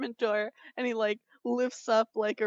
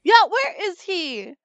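loud, slow, higher echo of the question; 7 semitones higher, 11 dB louder than usual. Real, not acted. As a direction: call it out louder than she did. Echoes the cry louder, slower.

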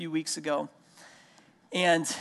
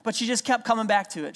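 usual speaking voice

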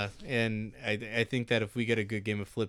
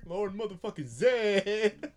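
wrestling announcer; 7 semitones higher, much slower than usual. Retold or imitated. Wrestling intro impression.

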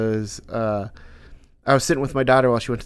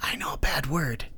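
put-on whispered little-kid confession; little daughter confiding; 7 dB quieter than usual. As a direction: whisper it like a child sharing a naughty secret. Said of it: "She'll be like".

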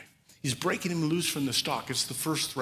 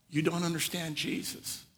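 ordinary talk, nothing marked

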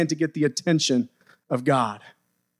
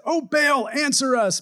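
high, pleading call to a false god; desperate cry to Baal; 7 semitones higher than usual. Retold or imitated. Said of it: "they danced and shouted".

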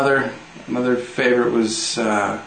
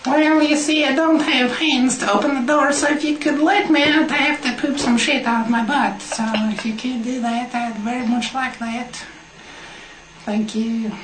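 very high, loud squeaky dog voice; Swedish dog voice; 4 dB louder than usual. Pretend. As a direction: ask politely as a squeaky little dog. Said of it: "Introduce the Swedish dog".

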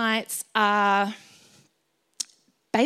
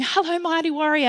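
higher-pitched voice calling out a greeting; lightly acted hail from on high; God calling out; 7 semitones higher than usual. God calling the speaker out, hailing call. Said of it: "God's calling me out going".

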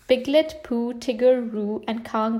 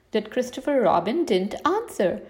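usual speaking voice

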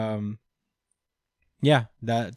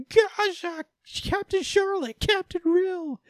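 very high, long drawn-out wailing calls; mock grief cries, very high; anguished cries; slower than usual. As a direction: wail their names as they die. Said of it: "everybody's like".

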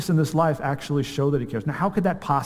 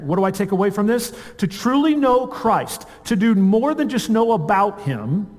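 higher-pitched, emphatic sermon delivery; impassioned preaching; 5 semitones higher than usual. Real, not acted. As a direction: preach it with mounting conviction, leaning on key words. Preaches at full force.

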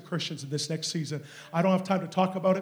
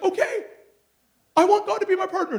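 very high, excited imitation of her; mock-eager high-pitched voice; eager agreement; 13 semitones higher, 8 dB louder than usual. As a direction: mimic her jumping in too soon. Woman agrees too eagerly.

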